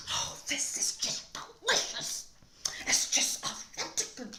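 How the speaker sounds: high, breathy whispered fake praise; mock whisper. Reader mocking restaurant food in a sarcastic whisper.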